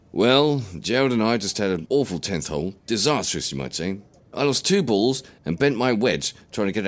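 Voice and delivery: put-on lively old grandad telling a story; old man voice. Grampy recounts golf mishap.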